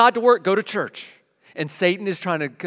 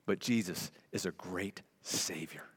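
whispered; 10 semitones lower, 13 dB quieter than usual. Real, not acted. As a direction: whisper "but Jesus is a great Savior" softly. Quote ends in a whisper.

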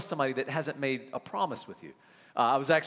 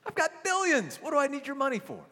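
mock dismissive billionaire; 12 semitones higher than usual. Elon voiced dismissive.

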